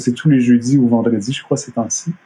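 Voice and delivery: usual speaking voice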